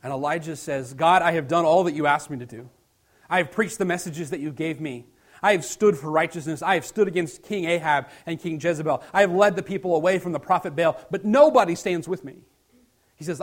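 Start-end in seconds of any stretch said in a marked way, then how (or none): 0.9-12.4 loud, insistent protest to God; acted exasperated outcry; impassioned complaint.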